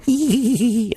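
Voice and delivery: high-pitched mocking giggle; put-on squeaky schoolgirl titter; chortling anime schoolgirl. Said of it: "my best impersonation of a chortling Japanese schoolgirl from an anime cartoon".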